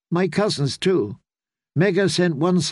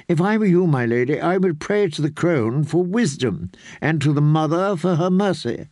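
ordinary delivery, nothing marked

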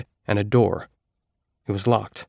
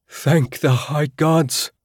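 slow, higher, drawn-out thanks; relieved cry; 6 semitones higher, much slower than usual. Acted. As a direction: pour out relief to the gods. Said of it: "Akka cried".

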